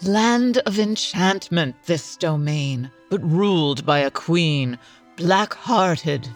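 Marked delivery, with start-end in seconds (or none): none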